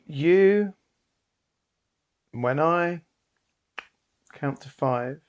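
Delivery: loud, slow stage command; booming showman decree; commanding hypnotist. Acted. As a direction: lay down the hypnotic order.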